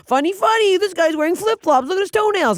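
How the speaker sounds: very high tearful squeal; mock bawling comedy. Comic retelling her act after wiping tears, high mock bawling. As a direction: crack jokes through hammed-up sobs. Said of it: "I'm, like, bawling".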